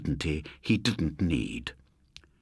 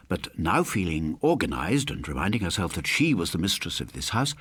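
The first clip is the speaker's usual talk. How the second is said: ordinary delivery, nothing marked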